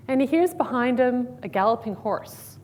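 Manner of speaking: normal talking voice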